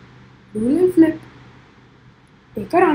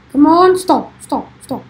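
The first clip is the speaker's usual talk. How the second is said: ordinary talk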